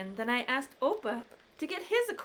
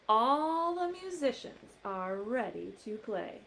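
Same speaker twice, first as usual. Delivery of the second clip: usual speaking voice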